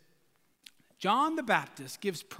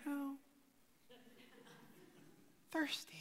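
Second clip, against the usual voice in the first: faint, limp whisper; weak whisper; 3 semitones higher, 20 dB quieter than usual. Shown as a demonstration. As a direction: barely breathe the words out. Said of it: "you are not saying".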